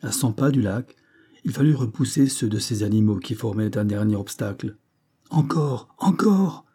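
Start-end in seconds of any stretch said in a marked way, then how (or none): 5.3-6.6 high call, climbing on the repeat; urgent cry. A narrator's reading. Banks urging the driver onward, high urgent cry. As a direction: urge him on, pitch jumping up. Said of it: "cria Banks au mécanicien".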